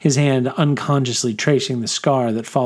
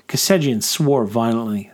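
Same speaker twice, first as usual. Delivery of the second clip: ordinary delivery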